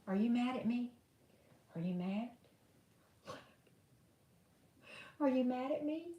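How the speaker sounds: soft coaxing question to a pet. Real, not acted. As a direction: gently ask the sulking dog. Talking softly to dog.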